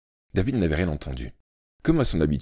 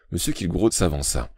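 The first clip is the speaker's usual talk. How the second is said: ordinary delivery, nothing marked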